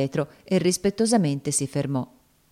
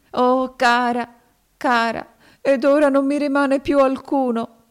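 put-on higher, louder sobbing girl; grieving cry; 9 semitones higher, 5 dB louder than usual. A narrator's reading. Topsy grieving for Eva, sobbing.

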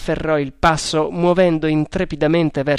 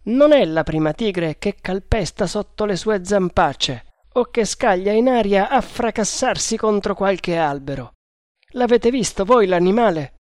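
sergente Bharata; 6 semitones higher than usual. Acted. Bharata parla col capitano.

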